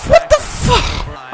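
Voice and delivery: very high, drawn-out outburst; high-pitched incredulous curse; shocked disbelief. Real, not acted. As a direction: squeal it slowly in disbelief. Disbelief at the play.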